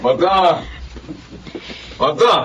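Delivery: calling a name across the yard. Acted. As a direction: shout the name to get her attention. Neighbor calling out to Magda.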